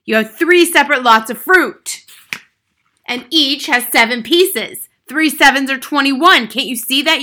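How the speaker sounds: bullying headmistress at full volume; shouted tirade. A narrator's reading. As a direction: rage at a hopeless pupil. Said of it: "shouted the Trunchbull".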